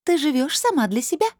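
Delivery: high voice turned cold; icy crystal voice. A narrator's reading. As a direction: dismiss it in a high, icy ring. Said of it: "Голос похолодел". (высокий голос, холодный как льдинки)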